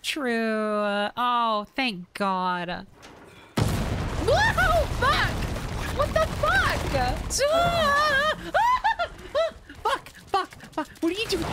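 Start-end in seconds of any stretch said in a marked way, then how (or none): 3.6-6.6 high, loud startled shriek; startled yell. Real, not acted. Player jump-scared, yelling high and loud. 6.7-9.8 startled laugh. Real, not acted. Laughing after scare.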